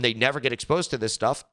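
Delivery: ordinary talk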